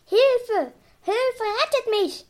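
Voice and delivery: high, loud storybook scream for rescue; acted cry for help. A narrator's reading. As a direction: call out high, as a drowning villain in a tale. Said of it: "schrie die böse Barbara". (gespielter hoher Hilfeschrei)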